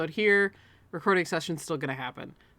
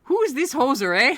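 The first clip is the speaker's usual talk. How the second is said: louder, higher mock Canadian jab; mock Canadian accent; 7 semitones higher, 8 dB louder than usual. Joking at the lawyer in a mock Canadian accent. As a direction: toss off a Canadian hoser line. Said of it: "I can't do a Canadian".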